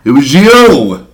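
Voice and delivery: mock accusing. Blames host in mock accusation.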